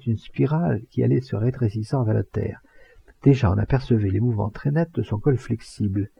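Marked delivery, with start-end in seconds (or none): none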